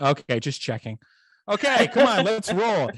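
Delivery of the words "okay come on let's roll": excited. Real, not acted. Urging the others to move on.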